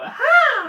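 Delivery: put-on short, high, loud girlish shriek; banshee scream. Shown as a demonstration. Friend showing how they screamed, banshee shriek.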